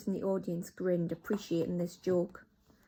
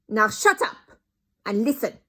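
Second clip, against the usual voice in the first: put-on louder, curt accented order; snapping; 3 semitones higher, 10 dB louder than usual. Grand High Witch silences her crowd, loud snap. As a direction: cut them off sharply. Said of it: "snapped the Grand High Witch".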